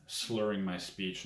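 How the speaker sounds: ordinary delivery, nothing marked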